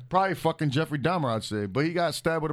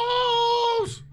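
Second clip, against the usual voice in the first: shouted name; 3 dB louder than usual. Acted.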